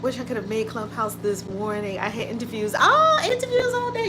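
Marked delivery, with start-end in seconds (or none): 2.8-4.1 high, amazed oh; surprised exclamation. Real, not acted. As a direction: act impressed at the news. Reacting to busy viewer.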